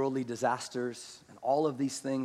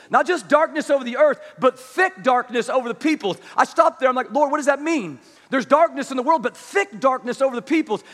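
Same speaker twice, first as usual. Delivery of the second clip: higher, louder fervent preaching to the congregation; impassioned preaching; 10 semitones higher, 12 dB louder than usual. Acted. Preacher pressing the church, louder and higher, fervent. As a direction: preach it out, full force, pleading.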